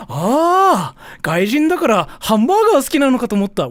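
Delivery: high, loud, amazed exclamation; Japanese: 驚いた高い声で; surprised manager. Retold or imitated. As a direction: blurt it like the shocked manager. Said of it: "And she was like".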